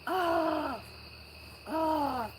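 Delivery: sharp high gasp of alarm; a sudden worried gasp; little high yelp of panic; startled gasp. Real, not acted. Startled gasp about camera.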